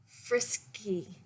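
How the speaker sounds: ordinary delivery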